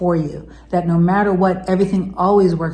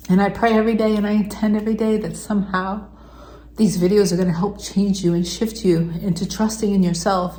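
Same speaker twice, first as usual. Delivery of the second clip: near tears, pleading with viewers to trust; heartfelt emotional plea. Real, not acted. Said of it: "this got super emotional".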